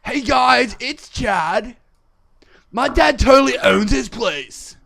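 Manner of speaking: high, loud, hammed-up American teen; mock American accent. Shown as a demonstration. Redoing his American accent for his friend, hammed-up. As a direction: brag like a spoiled American kid. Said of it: "what does that even sound like?".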